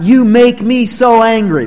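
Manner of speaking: loud, slow accusing line pinning the blame; blaming quote. Retold or imitated. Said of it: "that's the favorite".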